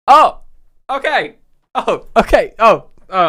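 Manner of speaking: high, loud, halting sarcastic shock; mock alarm. Call handler to customer threatening never to return, mock alarm. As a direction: burst out in short, high, fake-panicked ohs.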